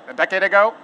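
ordinary talk, nothing marked

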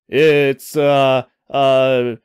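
drawn-out, halting hesitation; stalling for an answer. A narrator's reading. Ryan scrambles for a cover story, halting and stalling. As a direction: stall while inventing a lie. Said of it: "Ryan thought quickly".

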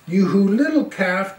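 ordinary delivery, nothing marked

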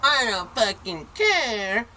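put-on high, loud, drawn-out kid's holler; mock bratty bellow; imitated defiant yell. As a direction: holler it slowly, like a bratty kid.

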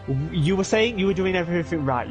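normal talking voice

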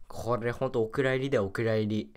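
normal talking voice

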